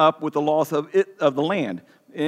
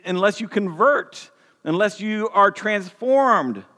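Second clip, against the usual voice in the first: ordinary talk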